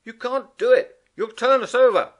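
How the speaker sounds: loud, high warning; alarmed shout. Acted. Rat warning Mole.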